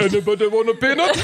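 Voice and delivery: goofy put-on peanut voice; hammed-up dopey voice; copied character voice. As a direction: mimic your friend's silly voice. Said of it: "Now you say it".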